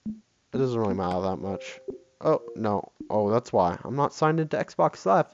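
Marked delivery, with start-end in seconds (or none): none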